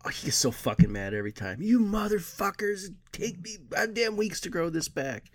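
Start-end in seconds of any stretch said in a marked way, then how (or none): 1.6-5.2 higher, slow, put-on furious whine; mock angry Dave. Dave furious at friends for burning his goatee, mock angry. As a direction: ham up his outrage, whining and high.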